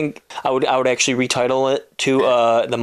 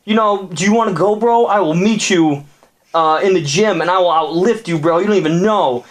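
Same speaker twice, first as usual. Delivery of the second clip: higher-pitched offended gym bro; indignant jock, higher voice; appalled gym bro; 5 semitones higher than usual. Acted. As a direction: puff up and challenge him, bro. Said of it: "he was appalled and said".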